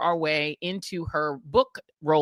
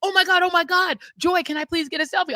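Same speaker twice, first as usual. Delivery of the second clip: high gushing fan voice; mock thrilled man asking for a selfie; imitating an excited fan; 11 semitones higher, 3 dB louder than usual. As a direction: gush it up high like a starstruck fan. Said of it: "a big, tall man is like".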